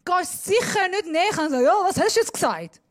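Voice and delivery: loud. Shown as a demonstration. Continues the shouting demonstration.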